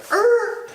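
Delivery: high, sharp skid noise; screeching stop sound; imitated tire screech. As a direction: mimic the brakes locking up. Cab skids to a stop.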